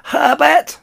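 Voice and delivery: shrill, very high scream; Missus Jenkins. A narrator's reading. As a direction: screech for a husband across the room. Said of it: "Missus Jenkins' shrill voice".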